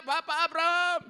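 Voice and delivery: loud, slow, high anguished call; desperate cry. Acted. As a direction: cry out to Abraham from the flames. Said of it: "dia teriak".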